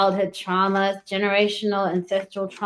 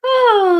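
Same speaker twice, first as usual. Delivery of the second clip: startled yelp; 12 semitones higher, 9 dB louder than usual. Real, not acted. Sudden yelp mid reading.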